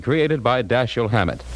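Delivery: normal talking voice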